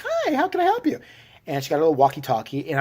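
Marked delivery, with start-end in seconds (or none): 0.0-1.0 much higher, bright chirpy clerk greeting; sing-song customer service hello; festive clerk. Retold or imitated. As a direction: chirp it like a cheery holiday cashier. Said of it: "all festive, like".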